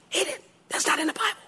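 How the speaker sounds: high, soft, hushed panic; frantic whisper. Retold or imitated. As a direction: whisper urgently to the wife across the church. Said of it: "I'm like".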